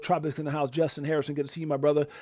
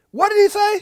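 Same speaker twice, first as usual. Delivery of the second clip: excited squeal; 10 dB louder than usual. Real, not acted. Hyping a quoted CEO clip.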